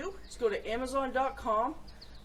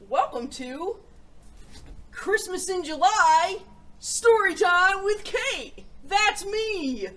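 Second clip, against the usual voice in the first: higher, louder, slow show-opening call; bright, drawn-out host welcome; excited announcement; 8 semitones higher, 9 dB louder, slower than usual. Real, not acted. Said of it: "Very excited".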